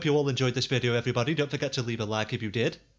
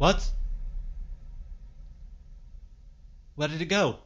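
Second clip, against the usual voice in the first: startled; 3 semitones higher than usual. Real, not acted. Sudden scare in horror game.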